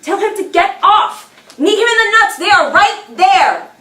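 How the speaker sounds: loud, urgent acted yelling; screaming. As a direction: scream desperate orders at your own body. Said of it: "silently screaming".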